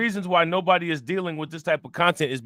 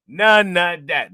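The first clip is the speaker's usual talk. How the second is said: "None of that" slow, high and loud; emphatic dismissal; 5 semitones higher, 7 dB louder, much slower than usual. Real, not acted. Host waving off code-switching excuses, slow emphatic "None of that". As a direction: punch "None of that", drawing each word out.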